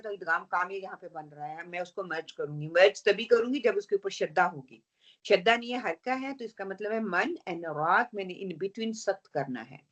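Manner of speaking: ordinary talk, nothing marked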